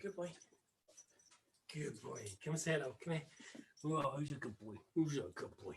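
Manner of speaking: quiet, affectionate coo to a puppy; soft puppy praise. Real, not acted. Owner fetching the puppy to say hello, softly cooing. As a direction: coax the little dog over gently, under your voice.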